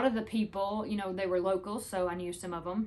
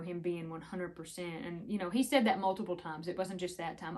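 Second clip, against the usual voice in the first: normal talking voice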